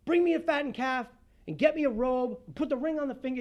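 father shouting to the servants for a party; joyful yell. Retold or imitated. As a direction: call out orders with glee. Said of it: "he yells to the servant".

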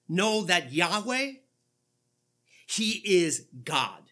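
weight every word, "Yahweh" held long; slow emphatic reading. Shown as a demonstration. Pastor rereads the verse with the divine name, slow and heavy. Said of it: "This is my name".

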